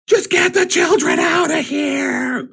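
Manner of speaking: high, loud, drawn-out yell; hammed-up high frantic shout; acted scream. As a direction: scream it out slowly over the chaos. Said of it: "I scream out".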